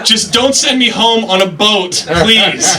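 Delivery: high, panicked stammer; flustered panic. Retold or imitated. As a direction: caught off guard ordering. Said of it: "you're like".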